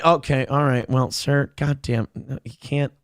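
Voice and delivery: normal talking voice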